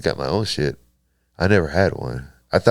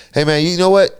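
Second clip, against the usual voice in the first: nervous pitch jump; 9 semitones higher, 3 dB louder than usual. Retold or imitated. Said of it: "so I was like".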